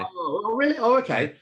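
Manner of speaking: high-pitched surprised reaction. Real, not acted. Guest hears the host doesn't mind either way, surprised. Surprised by listener's indifferent answer.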